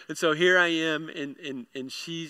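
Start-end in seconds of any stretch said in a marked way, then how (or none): none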